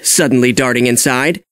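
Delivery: ordinary delivery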